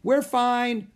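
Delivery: high, loud reply; shouting back. A narrator's reading. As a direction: call back reassurance over the wind. Shouting back through the storm.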